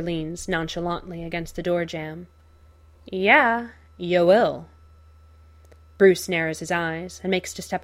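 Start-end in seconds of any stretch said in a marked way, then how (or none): 3.0-4.7 menacing. Acted. Joker blocking the door.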